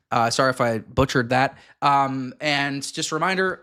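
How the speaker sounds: normal talking voice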